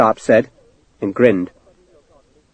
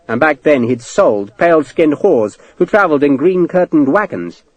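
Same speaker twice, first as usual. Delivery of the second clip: ordinary delivery, nothing marked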